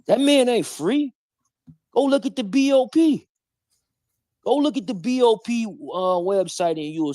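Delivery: high-pitched, insistent challenge; emphatic, pitched high. Real, not acted. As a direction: push the voice way up, insisting. Insists, challenging listeners to check.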